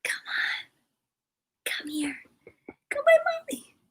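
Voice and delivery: hushed, pleading pet call; soft coaxing. Real, not acted. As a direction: coax the dog over very softly. Coaxing the dog to come over.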